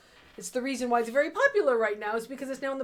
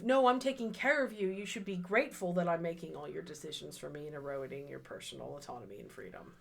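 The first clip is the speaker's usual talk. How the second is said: patronizing abuser; 5 semitones lower, 5 dB quieter, faster than usual. Retold or imitated. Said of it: "classic playbook of".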